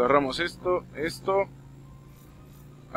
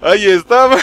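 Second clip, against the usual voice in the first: laughing through the words, louder; 7 semitones higher, 7 dB louder than usual. Real, not acted. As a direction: chuckle it out, amused. Encuentra al enemigo riendo.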